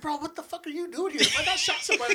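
high, laughing "bro, what the"; laughing disbelief. Retold or imitated. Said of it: "I was like".

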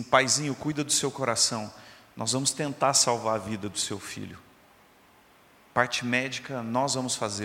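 low-pitched, subdued imitation of a detached doctor; cold, distant doctor. Doctor to the sick boy's father, mildly cold and distant. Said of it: "muito frio, distante de Deus".

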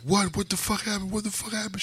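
high-pitched mock panic; alarmed. Dad retelling panic when mom runs in, high alarm. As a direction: squeak it out in alarm. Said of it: "And I'm like".

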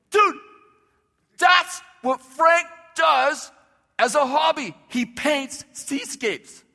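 loud, high, stretched-out amazement; freaking out. Retold or imitated. Tony hearing the pastor's vision of Frank, freaking out. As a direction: blurt it out astonished, dragging the words. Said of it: "Tony freaks out".

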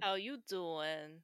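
drawn-out New York accent greeting. Shown as a demonstration. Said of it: "That's my New York accent".

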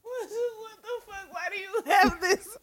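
shocked. Real, not acted. Shocked at friend's voice.

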